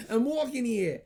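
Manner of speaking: loud, high New York shout; New York accent. Acted. Showing a friend his New York accent, loud and high. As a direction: holler it like a hammed-up New Yorker. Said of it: "I can't really do it in New York".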